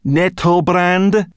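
slow, loud, high and pointed; impatient repeat. Acted. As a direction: spell it out, losing patience. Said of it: "repeated Sorrel impatiently".

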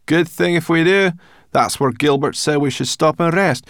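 loud, high, stubborn brownie; Sorrel the brownie. A narrator's reading. Sorrel arguing about where to rest, stubborn and loud. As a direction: argue back, sure of yourself.

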